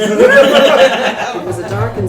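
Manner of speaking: mock dramatic narrator. Writer playing along with the joke, laughing hammed-up narrator. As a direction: ham up the clichéd opening through a laugh.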